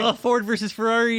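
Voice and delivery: hoarse marathon cheering. Real, not acted. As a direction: yell the answer out in triumph. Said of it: "he's about to explode".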